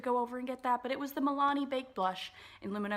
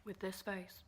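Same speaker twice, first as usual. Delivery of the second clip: much softer "with this face", this stressed; quiet repeat; 9 dB quieter than usual. Pretend. Repeating the line to viewers on the other side, softer. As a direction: drop "with this face" to a soft undertone. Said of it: "Why am I doing these accents?".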